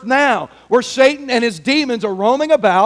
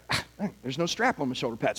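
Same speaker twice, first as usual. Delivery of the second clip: low, soft grumble to himself; muttered frustration; 10 semitones lower, 14 dB quieter than usual. Acted. Player finding no strap on pads, muttered frustration. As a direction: grumble quietly at a small setback. Dreamer finds another gear problem.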